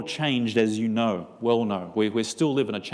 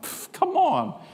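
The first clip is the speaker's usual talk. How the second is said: scoffing; slower than usual. Retold or imitated. Scoffing at coworker.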